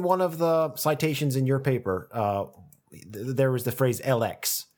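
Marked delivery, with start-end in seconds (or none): none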